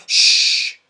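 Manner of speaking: sharp unvoiced hushing; group shushing a squeal; loud shush. A narrator's reading. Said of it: "said everyone at once".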